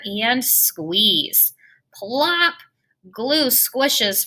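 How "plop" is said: usual speaking voice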